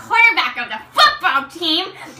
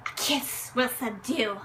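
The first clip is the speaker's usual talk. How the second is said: seductive murmur; 3 semitones lower, 10 dB quieter than usual. Acted. Seductive line moving closer.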